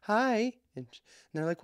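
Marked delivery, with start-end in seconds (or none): 0.0-0.5 put-on very high child's greeting; tiny high-pitched kid voice; imitated little girls. Little girls greeting his daughter, imitated child voice.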